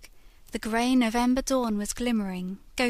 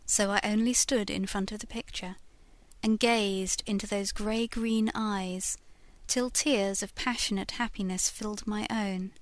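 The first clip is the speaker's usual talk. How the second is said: ordinary delivery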